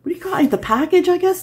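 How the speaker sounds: unsure, high. Real, not acted. Unsure what to call it.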